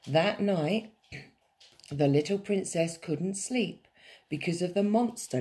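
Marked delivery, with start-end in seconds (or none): none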